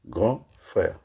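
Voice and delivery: two words set well apart; syllable by syllable. Shown as a demonstration. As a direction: separate each syllable with a gap. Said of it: "Il y a deux syllabes".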